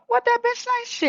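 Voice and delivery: gossip voice. Retold or imitated. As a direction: spit it like juicy gossip. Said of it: "and some".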